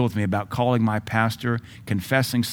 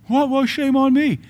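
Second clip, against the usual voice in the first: much higher, put-on offended voice; indignant complainer; 13 semitones higher than usual. Preacher mimics a late worker protesting his rebuke, indignant. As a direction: play the affronted worker. Acted indignant church member.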